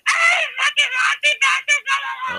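high, slow shrill mimic of a girl yelling from afar; mocking girl's shriek. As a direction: screech it in a mock girlish voice across the street. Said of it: "I just heard, like".

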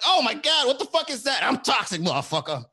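high, loud comic panic turning aggressive; hammed-up venom-struck outburst; mock toxic freak-out. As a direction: react as if poisoned into rage. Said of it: "it feels like a venom bite".

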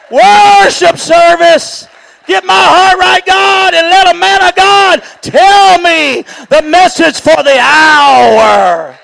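loud, high, drawn-out preaching cries; preacher driving the church toward worship, shouting; preaching at full force. Acted. Preacher calling his church to true worship, full-force preaching cry.

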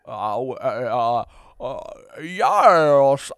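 loud, hammed-up British yes after hesitant stammers; failed British accent. Asked about a British movie, failed British accent. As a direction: attempt a British accent and botch it. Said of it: "I can't do a British accent".

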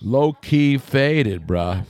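very slow, drawn-out stoner drawl. Pretend. Stoner slang joke.

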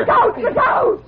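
high, loud cry; shouted warning. Acted. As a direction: scream a warning. Girl warns of cliff edge.

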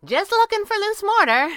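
high, loud, offhand answer; breezy call. A narrator's reading. She answers from up the wall, breezy call.

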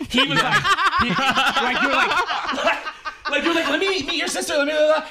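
high laughing retelling. Retold or imitated.

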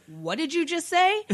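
slow, high mock-offended question; stunned disbelief. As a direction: retell it as an appalled demand to repeat that. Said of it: "face just drops and he goes".

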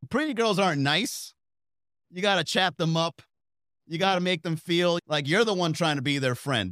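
ordinary talk, nothing marked

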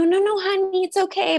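hammed-up sweet nurse soothing; mocking nurse. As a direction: coo at her like a patronizing nurse. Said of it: "She's like".

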